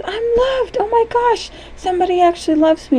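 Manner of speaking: very high cartoon voice, gushing; overjoyed little character. Smiley discovers somebody loves him, high overjoyed voice. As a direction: gush in a tiny, squeaky delighted voice. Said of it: "And he goes".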